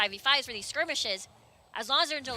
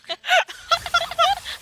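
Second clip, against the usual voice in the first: laughing; 5 dB louder than usual. Real, not acted. Laughing at the cat hiss.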